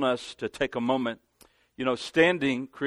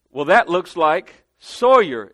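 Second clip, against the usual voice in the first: slow, louder, higher teasing remark; playful tease; 5 semitones higher, 10 dB louder, slower than usual. Retold or imitated. To Sawyer holding donuts, a slow loud higher tease. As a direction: rib the boy about his donuts.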